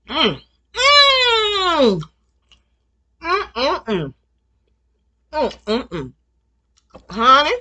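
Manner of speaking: loud eating with mouth full. Real, not acted. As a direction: savor it through a mouthful. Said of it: "it's okay to talk with your mouth full".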